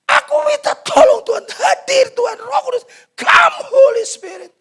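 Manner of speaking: loud, very high wailing plea; acted tearful invocation; crying prayer. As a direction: cry out desperately to the Holy Spirit. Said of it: "Dia nangis".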